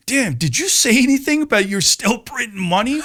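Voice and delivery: high-pitched worried self-questioning; retold nervous thought. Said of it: "I was nervous thinking".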